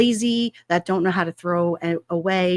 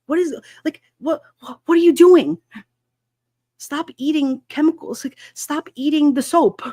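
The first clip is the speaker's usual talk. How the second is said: exasperated; 6 semitones higher, 4 dB louder than usual. Real, not acted. Scolding a man who ate soap.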